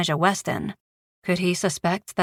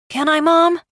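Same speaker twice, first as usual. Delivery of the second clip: put-on eager boy; 10 semitones higher, 5 dB louder than usual. Boy eagerly asking mother.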